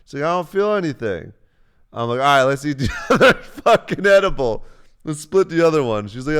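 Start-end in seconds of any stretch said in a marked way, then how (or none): 0.2-1.3 whiny complaint. Retold or imitated. Girlfriend complaining edible not working. 2.2-6.1 louder and higher, laughing through it; egging her on to eat more; excited loud push. Retold or imitated. To girlfriend about splitting the edible, loud excited.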